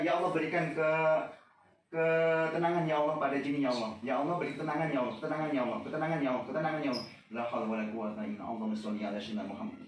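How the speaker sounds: murmured prayer. Real, not acted. Healer praying for the jinn to be calmed, low and hushed. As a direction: pray for calm, low and hushed. Prays for calm over the jinn.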